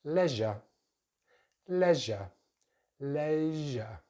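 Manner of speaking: "leisure" as LEZH-uh; "leisure" with short e, no final r; British pronunciation. Shown as a demonstration. Teacher models next word, "leisure" as LEZH-uh. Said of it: "Next word in British English".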